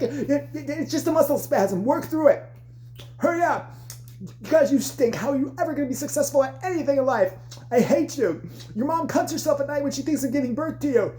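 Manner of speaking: high, hectoring mock abuse; berating dance coach. Coach dismissing a dancer's injury, mock berating.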